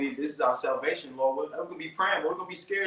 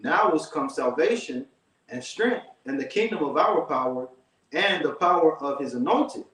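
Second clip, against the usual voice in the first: louder, steady Bible reading; scripture reading; 4 dB louder than usual. Acted. Reading Revelation to his brother, firm louder scripture reading. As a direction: read it out with weight, like from a pulpit. Reads verse ten.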